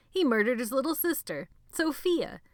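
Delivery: high, loud, cruel exposure; loud gleeful accusation. Acted. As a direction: announce it to the room. Benny exposing him loudly.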